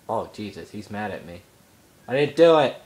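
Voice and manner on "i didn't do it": louder, higher protest; protesting yell. Real, not acted. Gamer telling the mad dog it wasn't them, protesting loudly. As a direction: protest your innocence loudly.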